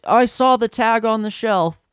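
irate customer. Retold or imitated. Upset guest arguing over the shelf tag, irate. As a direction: imitate an outraged shopper insisting on proof. Angry guest argues about the price.

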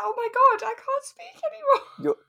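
ordinary talk, nothing marked

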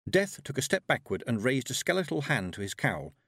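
usual speaking voice

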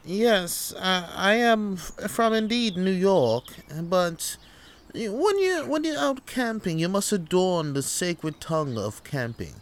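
hammed-up English accent, stumbling grandly; put-on English accent. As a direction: defend yourself in affected English tones. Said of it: "you're talking with an English accent".

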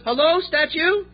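slow answering-the-phone hello with a high rise on "Hello"; phone greeting. Retold or imitated. Mafia boss answering a phone call, punchline. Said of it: "Ring, ring".

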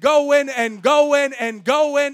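loud, drawn-out "going and going", rolling on; unstoppable repetition. Real, not acted. Preacher on sins thrown endlessly away, Energizer Bunny repetition. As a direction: chant it like a machine that won't stop. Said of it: "I sound like the Energizer Bunny".

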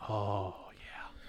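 breathy whispered oh yeah. Real, not acted. Whispering his crush on an actress. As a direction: whisper it with relish. Savoring thought of Southern girls.

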